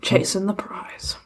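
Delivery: soft breathy whisper. Acted. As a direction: whisper it like a secret. Suggesting a song title.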